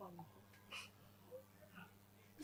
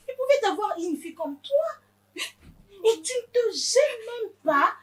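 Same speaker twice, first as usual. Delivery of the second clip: higher-pitched, anguished reproach; wounded mother's tirade; outraged scolding; 5 semitones higher than usual. Acted. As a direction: scold her in disbelief, voice raised high. Mother scolding her crying daughter.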